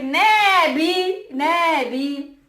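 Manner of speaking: high, loud brushing-off nah B; dismissive nah. Retold or imitated. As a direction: wave it away loudly.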